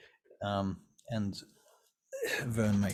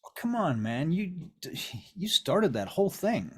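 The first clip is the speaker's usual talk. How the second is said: high-pitched, indignant pleading; whining protest; 8 semitones higher, 3 dB louder than usual. Acted. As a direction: push the blame back, voice swooping up. Vern protests when blamed by Abby.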